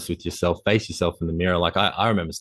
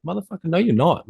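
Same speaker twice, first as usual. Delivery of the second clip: high, punchy rebuke to yourself; self-scolding; 10 semitones higher, 3 dB louder than usual. Retold or imitated. Man scolding his complacent self, high and forceful. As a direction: call your own bluff, pitched up and rising in force.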